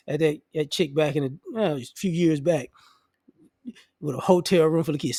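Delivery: ordinary talk, nothing marked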